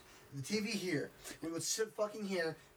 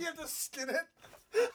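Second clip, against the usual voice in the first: laughing; 8 semitones higher, much slower than usual. Real, not acted. Laughing at crocodile bar mitzvah joke.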